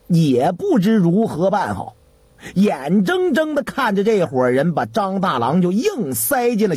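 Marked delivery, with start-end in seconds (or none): none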